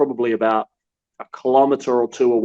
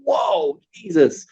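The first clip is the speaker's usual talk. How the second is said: shocked exclamation; 14 semitones higher, much slower than usual. Retold or imitated. Journalist reacting to a gun at his head, startled high whoa. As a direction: jump up in pitch as if a gun is in your face. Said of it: "I'm like".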